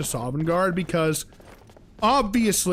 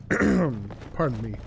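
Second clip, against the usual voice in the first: slow, strained, lower and softer; holding back a cough; 7 semitones lower, 8 dB quieter, slower than usual. Real, not acted. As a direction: talk while suppressing a cough. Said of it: "so I'm holding the cough down".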